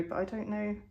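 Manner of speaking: ordinary talk, nothing marked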